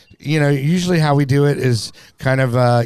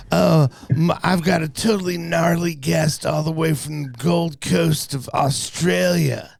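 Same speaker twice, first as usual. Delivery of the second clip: higher, hyped prankster-host voice with a laugh in it; Steve-O impression; 5 semitones higher than usual. Host introducing an Australian guest, as a pitched-up hyped prankster bro. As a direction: ham up a party bro introducing a gnarly guest. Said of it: "I need to work on my, my Steve-O".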